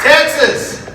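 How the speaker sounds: high, loud call of the state name; rally the crowd with one big shout; shouted greeting. Real, not acted. Calling out to the crowd.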